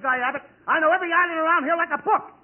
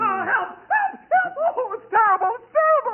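high, hammed-up cries for help; mock panic; 9 semitones higher than usual. Costello cries for help in high hammed-up panic. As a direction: squeal like you're in the pot.

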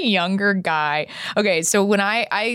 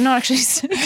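put-on light British accent through a laugh; lightly voiced British boyfriend confessing; 7 semitones higher, much faster than usual. Retelling the boyfriend's confession in a light British accent. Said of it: "the power of that accent".

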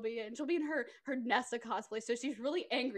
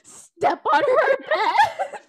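much louder, much higher and slow, breaking into laughter; melodramatic command; 12 semitones higher, 13 dB louder, much slower than usual. Pretend. Friend voicing Kayla's dramatic demand in the pitched film. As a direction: bellow it like a soap opera villain. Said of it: "you see Kayla go".

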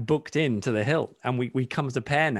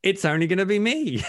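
mock worry; 7 semitones higher than usual. Quoting own worried thought.

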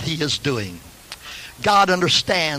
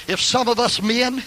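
building preaching; 6 semitones higher than usual. Real, not acted. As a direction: pitch climbing steadily into an impatient call. Preaching, building to acted impatient husband.

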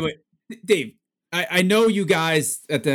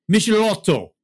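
put-on high, loud Italian-accented flourish; Max Girardo's Italian accent; 8 semitones higher, 5 dB louder than usual. Co-host attempts to replicate an auctioneer's pronunciation, imitated Italian accent. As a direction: belt out the name with a hammed-up Italian accent. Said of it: "replicate how he says it".

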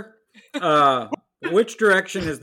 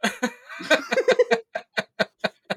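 high burst of laughter. Real, not acted. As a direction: crack up high. Laughing at lyric joke.

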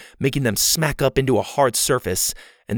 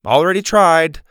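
raised, higher voice; calling after someone; 4 semitones higher, 6 dB louder than usual. A narrator's reading. Boy calling after a girl walking off, raised voice. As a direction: call it out after her. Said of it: "Mac called after her".

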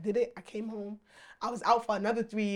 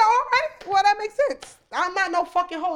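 high, loud sarcastic comeback; loud mock retort; 10 semitones higher, 7 dB louder, slower than usual. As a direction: fire back loud and high. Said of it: "I said".

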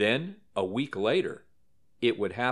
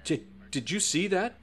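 mild narrated shout; 9 semitones higher than usual. A narrator's reading. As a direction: stammer it out as a restrained, high-pitched shout. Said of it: "Conklin shouts".

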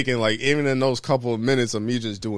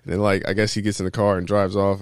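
normal talking voice

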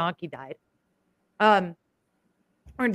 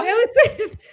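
laughing; 12 semitones higher, 8 dB louder than usual. Real, not acted. Laughs while speaking.

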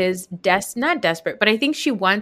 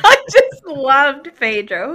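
loud, slow, laughing through it; laughing shout; 8 semitones higher, 9 dB louder, slower than usual. Real, not acted. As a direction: burst out laughing. Laughing outburst.

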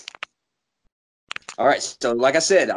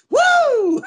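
high, loud mock whoop; 5 dB louder than usual. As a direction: throw back a big whoop. Mocking friend's cheer.

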